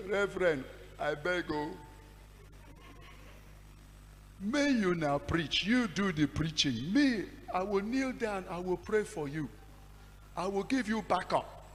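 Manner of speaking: put-on a young pastor pleading with his senior; frightened pleading. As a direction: beg to be spared.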